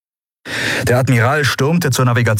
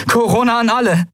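calling out. Acted. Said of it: "rief sie in den Kubusaufzeichner".

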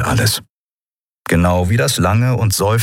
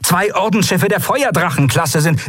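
urgent report; 11 semitones higher than usual. Acted. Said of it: "rief Quailor".

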